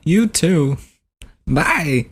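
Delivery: high-pitched celebrity impression farewell; Christopher Walken. Prank caller saying goodbye, celebrity impression. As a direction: sign off high and lilting as the star. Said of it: "I should have just been like".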